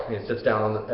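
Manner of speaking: ordinary delivery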